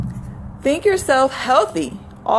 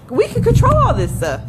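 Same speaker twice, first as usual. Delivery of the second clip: emphatic; 11 semitones higher, 3 dB louder than usual. Acted. Emphatic point to listeners.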